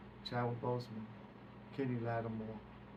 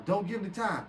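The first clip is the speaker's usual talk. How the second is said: Fruity's warning; 7 semitones higher, 6 dB louder than usual. Retold or imitated. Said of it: "So now I'm getting Fruity".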